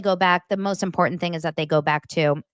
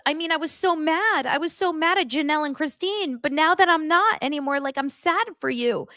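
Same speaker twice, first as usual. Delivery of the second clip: mock emotional; 9 semitones higher than usual. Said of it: "he goes".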